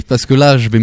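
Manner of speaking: normal talking voice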